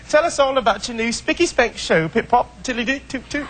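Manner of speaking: a terrible fake British accent, clearly played for laughs; mock British accent. American host trying a British accent, hammed-up and high. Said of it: "May I adopt your accent".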